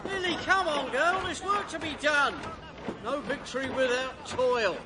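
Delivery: high call across the room to Millie. Acted. Gabriel calling Millie over to get to work, high and carrying. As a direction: call her over, bossy and bright.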